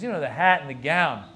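loud, lightly voiced older club owner; Mitzi. Club owner explaining the outfit, lightly impersonated. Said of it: "Mitzi talked like this".

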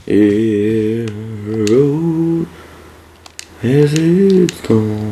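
slow, drawn-out mock-portentous intoning; a hint of a doom-laden narrator; mock solemn. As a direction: ham up the game's grave motto, very slowly. Mocking the game's solemn line.

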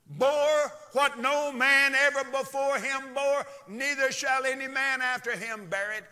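high, loud sustained preacher delivery; full-force preaching. Acted. Preacher to congregation on what Christ bore, high loud preaching.

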